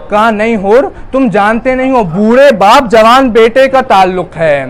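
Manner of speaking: high, loud wailing preacher's lament, slowed; lamenting sermon. Acted. Preacher recounts Karbala's grief to mourners, high loud wailing lament. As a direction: cry the tragedy out to the mourners. Masaib recitation at full force.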